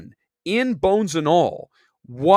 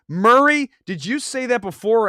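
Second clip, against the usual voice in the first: excited call-out; 6 semitones higher, 6 dB louder than usual. Real, not acted. Calling out to a chat commenter.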